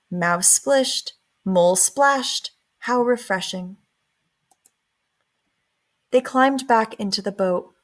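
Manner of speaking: ordinary delivery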